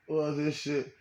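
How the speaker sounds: ordinary talk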